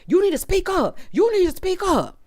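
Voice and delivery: high, needling mock voice; mocking the older man. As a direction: parrot him high and pushy, teasing. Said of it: "they telling him".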